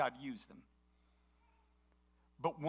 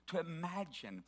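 normal talking voice

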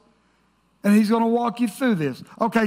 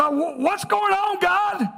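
much higher, exasperated call upward; mock cry to God; 9 semitones higher, slower than usual. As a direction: cry out to God, fed up and lost. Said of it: "Then we say".

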